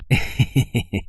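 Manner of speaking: sly villain's chuckle to himself; gloating laugh. Acted. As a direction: laugh like a thief who got away with it. Thief gloats over stolen idol.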